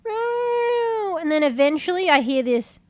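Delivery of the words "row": telling off the cat. Retold or imitated. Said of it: "and I'm like".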